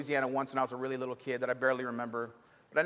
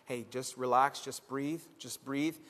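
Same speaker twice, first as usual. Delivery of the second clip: softer, lower, gentle calming voice; reassuring teacher; 4 semitones lower, 7 dB quieter, slower than usual. Retold or imitated. Acting teacher calming a panicking student, softly reassuring. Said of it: "he said".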